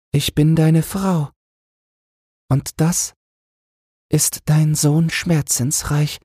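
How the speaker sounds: high, gentle voice of the poor queen; the queen asks the angel, in a high gentle voice. A narrator's reading. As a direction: play the humble queen asking in wonder.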